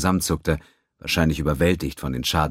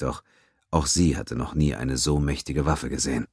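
ordinary talk, nothing marked

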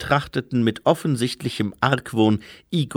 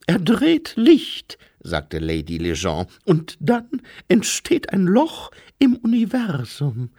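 Lady Lejeune's voice; 8 semitones higher, 3 dB louder than usual. Acted. To the doctor, high airy noblewoman explaining.

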